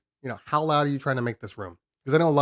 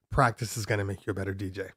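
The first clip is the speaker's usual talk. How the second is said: usual speaking voice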